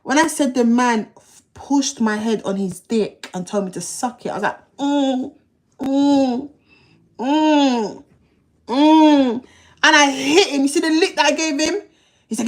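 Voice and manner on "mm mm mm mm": muffled panicked mm protests. Retold or imitated. Head forced down, protesting.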